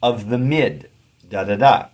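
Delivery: "of the mid" with the stress on MID, then da-da-DA; anapest beat. Shown as a demonstration. Teacher showing the three-syllable foot, anapest beat. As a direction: punch the third beat in "of the mid, da da da". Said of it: "That's an anapest".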